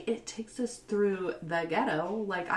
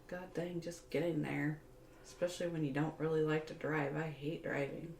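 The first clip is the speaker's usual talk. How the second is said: ordinary talk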